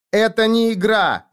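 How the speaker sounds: high, loud, slow shout; furious cry, each word pushed out. Acted. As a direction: yell it in fury. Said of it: "закричала я".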